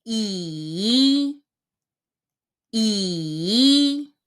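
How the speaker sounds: 姨 dipped low then up, third tone; 姨 in a low dipping third tone. Shown as a demonstration. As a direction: say 姨 falling then rising. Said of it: "the third tone".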